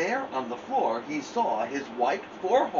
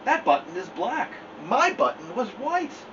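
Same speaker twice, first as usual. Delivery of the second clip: higher, insistent Toad voice; fussy Toad; 7 semitones higher than usual. Acted. Toad protesting to Frog, higher and insistent. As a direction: Toad protesting it is not his.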